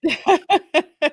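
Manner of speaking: high peal of real laughter; laughing. Real, not acted. Co-host reacting to the chat greeting, high laughter. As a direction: burst out laughing.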